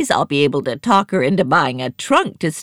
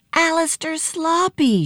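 high-pitched cry of recognition; acted high gasp of discovery; startled cry; 12 semitones higher than usual. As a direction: cry out the name in amazement. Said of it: "she cried".

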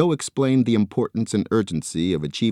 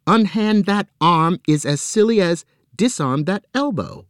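high, prim lecturing voice; imitating Nancy; 11 semitones higher, 4 dB louder than usual. Joe mimicking sister Nancy, high and prim. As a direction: put on his sister's tone. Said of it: "She would say".

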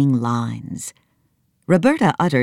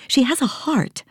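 put-on girl voice; 12 semitones higher than usual. Nancy voiced.

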